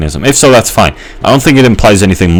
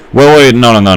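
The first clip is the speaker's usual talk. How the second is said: urgent; 6 semitones higher, 5 dB louder than usual. Real, not acted. Correcting himself mid-thought.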